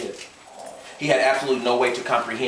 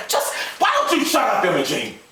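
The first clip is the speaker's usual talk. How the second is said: angry snap; 10 semitones higher than usual. A narrator's reading. Gus snapping at wife.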